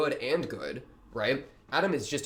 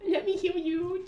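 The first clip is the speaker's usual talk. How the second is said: very high, drawn-out falsetto mimicry; Charlie. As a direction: mimic her in a slow, squeaky-high voice. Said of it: "And then Charlie's like".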